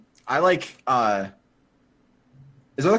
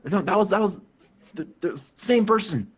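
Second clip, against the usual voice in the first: ordinary talk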